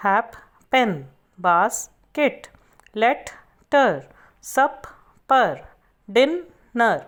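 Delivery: each word broken between its middle consonants, hap, pen; isolated syllables with a clear pause after each; split into syllables. Shown as a demonstration. Teacher shows learners where double-letter words divide, syllable by syllable. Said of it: "Split words that have two middle consonants".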